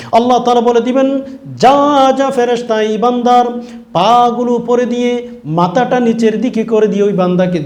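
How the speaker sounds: high, loud command of judgement; thundering preaching. Acted. Preacher voicing Allah sending a sinner to hell, thundering preaching. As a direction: cry out the sentence to the angels. Preacher voices God's sentence.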